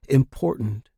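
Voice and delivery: plain reading voice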